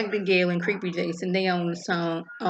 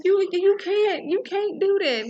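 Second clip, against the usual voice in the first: panicked shriek; 13 semitones higher, 4 dB louder than usual. Retold or imitated. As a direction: wail it out in mock panic. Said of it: "She's like".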